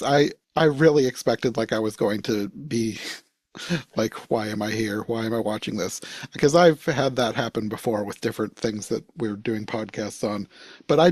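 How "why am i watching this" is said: ordinary talk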